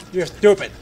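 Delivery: a punchy little accusation; mock insult. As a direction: throw a playful insult out loud. Argues with himself in two parts.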